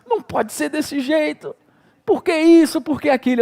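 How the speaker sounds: high, pleading mock cry to God; a half-acted sob of protest, rising at the end; imitating tearful pleading. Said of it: "mas chorava mesmo assim".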